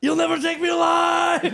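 high, loud, defiant yell; defiant shout. Pretend. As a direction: yell it to the sky. Imagined drunk yelling.

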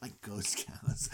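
whispered low and slow; a whispered ghost-cow aside; spooky whisper. Retold or imitated. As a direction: breathe it like a ghost story.

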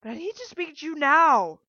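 very loud, high, insistent cry; wailing demand. A narrator's reading. Mother locked out by daughter, wailing demand. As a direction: wail the demand through the door.

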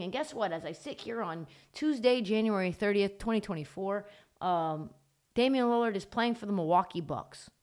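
ordinary talk

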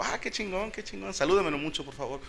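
high, soft, fake-nice; mock polite enthusiasm. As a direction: fake delight at the news. Él responde, fingido. (entusiasmo fingido, agudo y suave)

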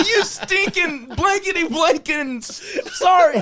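drill sergeant cussing. Retold or imitated. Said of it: "He's all".

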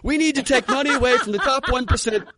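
high, fast, laughing mock Bernie; Bernie Sanders impression. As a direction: blurt the slogan at a question about lunch. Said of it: "Hey, Bernie, what'd you have for lunch?".

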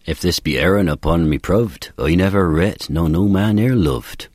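sonnet in Elizabethan original pronunciation. Shown as a demonstration. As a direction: read it so proved rhymes with loved. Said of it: "So the real version is".

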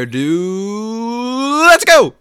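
high, drawn-out build to a loud yelled "let's go"; slow wind-up, then a big loud "let's go!"; hype shout. Acted. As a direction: fire up the listeners like a stadium MC. Hyping the interview start.

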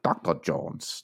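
attempted movie German accent. Co-host corrects his misquoted film line, mock German accent. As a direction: Indiana Jones villain's German accent. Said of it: "only German accent I could replicate".

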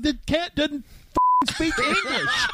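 high-pitched, incredulous mock protest; exasperated retort. Telling friend the cat can't understand him, high exasperated retort. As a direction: pitch way up, breaking up on the last words.

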